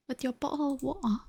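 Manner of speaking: put-on light cheeky British accent; mock British accent. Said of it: "stereotypical British accent".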